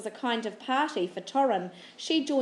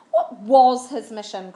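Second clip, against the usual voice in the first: loud and slow, pleading; crying out; 9 dB louder than usual. A narrator's reading. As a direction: demand it urgently. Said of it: "cried Torrin".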